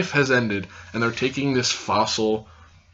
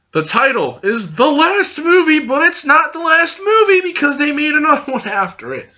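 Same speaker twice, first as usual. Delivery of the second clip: squeaky, much higher protest; high-pitched exasperation; 14 semitones higher, 4 dB louder than usual. Real, not acted. Fan protesting that it's not the last movie, high-pitched exasperation. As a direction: complain in a very high, sustained voice. Exasperated rant about misleading title.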